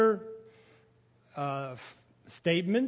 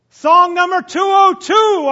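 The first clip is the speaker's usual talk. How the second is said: higher, shouted hymn call; loud shout; 11 semitones higher, 16 dB louder than usual. Retold or imitated. Song leader shouting the hymn number to people afar off. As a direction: shout the number across the hall. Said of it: "he says in a loud voice".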